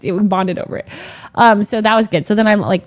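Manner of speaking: ordinary delivery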